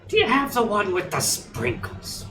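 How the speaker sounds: high, slow, grating mockery of a question; Gilbert Gottfried voice. Husband mocking his wife's drive-thru order in a squawky comic voice. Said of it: "Gilbert Godfrey type voice to make fun of her".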